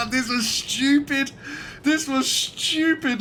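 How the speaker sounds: high, slow laughing groan; laughing despair. Real, not acted. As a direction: laugh through the disbelief. Chaos under fire.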